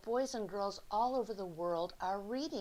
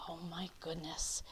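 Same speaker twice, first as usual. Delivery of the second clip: whisper; 3 semitones lower, 6 dB quieter than usual. Real, not acted. Aside to kids while reading.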